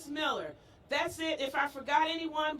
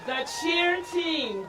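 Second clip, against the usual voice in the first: drawn-out, higher announcer call to the crowd; hyped announcer call; 4 semitones higher, 4 dB louder, slower than usual. Real, not acted. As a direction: stretch it out and hype the team. Announcing cheer team to crowd.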